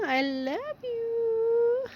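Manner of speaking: put-on very high, slow whimpering baby voice; voicing the cat. Owner speaks as her cat, high and whimpering. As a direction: whine it like a tiny kitten. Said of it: "say hi to our viewers".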